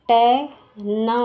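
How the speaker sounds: टै ना spoken slowly in parts; syllable by syllable. Shown as a demonstration. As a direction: split the word into slow syllables. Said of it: "और ये का".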